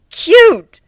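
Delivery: very high, loud delighted squeal; excited squeal. Acted. Cat girl seeing shy pegasus, loud delighted squeal. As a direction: gush over something adorable. Nadine squeals over Fluttershy.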